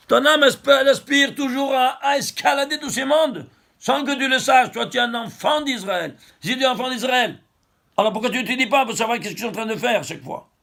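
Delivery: put-on impassioned exhortation to a child of Israel; exhorting sermon. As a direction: urge the listener, voice lifted high. Exhorting the listener.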